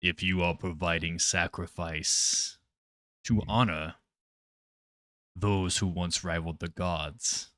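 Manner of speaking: hushed, deep-pitched voice of a haughty deity; ominous old god. Acted. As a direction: speak quietly into their minds as an ancient god. Game master voices old god in mind.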